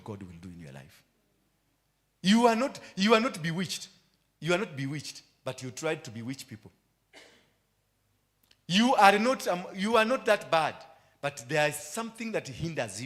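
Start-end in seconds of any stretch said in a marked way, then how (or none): 2.2-5.2 high and loud declaration; loud, high sermon cry; shouted preaching. Acted. Preaching climax. 8.7-10.7 high and loud declaration; loud, high sermon cry; shouted preaching. Acted. Preaching climax.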